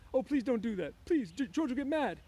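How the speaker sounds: high, loud, frantic plea; panicked begging. A narrator's reading. Lenny silencing the screaming woman, begging in panic. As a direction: plead desperately at the top of the voice. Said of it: "he begged".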